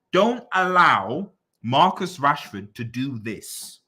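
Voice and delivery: "Don't allow" drawn out slowly, each word pressed; slow and emphatic. Shown as a demonstration. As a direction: spell it out slowly like a warning. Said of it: "I'm saying it again".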